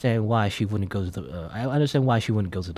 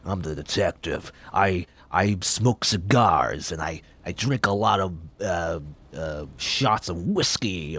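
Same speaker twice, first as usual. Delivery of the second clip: lightly gruff noir detective, lower and slow; a hint of a hard-boiled noir detective; 4 semitones lower, slower than usual. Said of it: "I just think of, like, a gruff guy".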